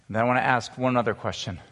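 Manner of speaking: normal talking voice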